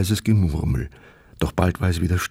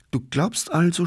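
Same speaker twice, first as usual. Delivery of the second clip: mocking; 9 semitones higher than usual. A narrator's reading. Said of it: "spottete Sepp".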